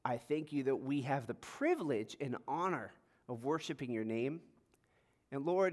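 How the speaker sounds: hushed sermon-opening prayer. Real, not acted. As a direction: pray quietly and earnestly, with pauses. Opens with a prayer.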